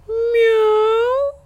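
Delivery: cat meow. A narrator's reading. Cat meows.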